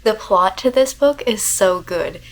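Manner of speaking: normal talking voice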